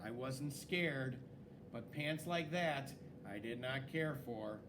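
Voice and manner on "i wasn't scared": ordinary delivery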